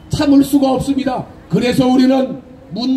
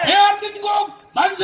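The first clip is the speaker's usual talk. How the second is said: shrill echoing propaganda broadcast; 6 semitones higher, 3 dB quieter than usual. Real, not acted. Leading cheers.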